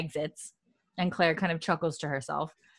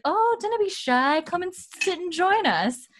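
high, slow, sing-out invitation; welcoming brothel lady; 12 semitones higher than usual. Acted. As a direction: coax the newcomer over in a high put-on voice. Brothel ladies invite newcomer to table.

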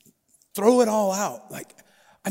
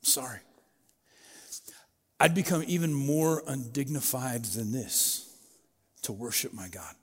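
humble reply; 4 semitones lower, 4 dB quieter than usual. Retold or imitated. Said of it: "He says".

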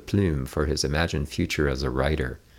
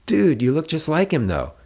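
put-on high, louder teasing teen girl; high playful girl voice; 11 semitones higher, 4 dB louder than usual. A narrator's reading.

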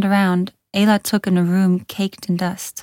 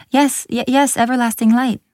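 higher, stammered confirmation; flustered, keen young voice; nervous eagerness; 5 semitones higher, 3 dB louder than usual. A narrator's reading. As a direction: stumble on the yes, then push on. Nervous girl breaks the silence.

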